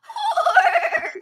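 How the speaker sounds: very high, loud shouted word; high laughing Willow shriek. Retold or imitated.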